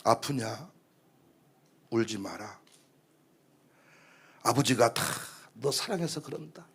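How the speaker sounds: hushed, low and tender; soothing mother. Acted. Mother consoles child scolded by father, soft and soothing. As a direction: comfort a crying child very softly. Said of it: "엄마가 찾아와서".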